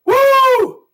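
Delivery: high, loud joyful woo; ecstatic whoop. Real, not acted. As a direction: whoop it out like a revival shout. Revival whoop.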